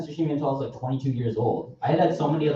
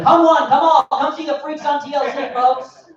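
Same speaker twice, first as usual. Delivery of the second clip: high, loud carnival barker; 6 dB louder than usual. Retold or imitated. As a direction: hawk the freaks to passers-by. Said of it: "should be the spokesperson".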